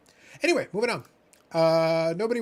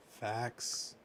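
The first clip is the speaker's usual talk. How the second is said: ordinary talk, nothing marked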